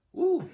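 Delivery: high, loud "woo" of release; sudden high whoop, overcome by the scene; emotional woo. Real, not acted.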